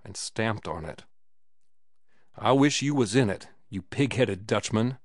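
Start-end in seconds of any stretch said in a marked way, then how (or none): none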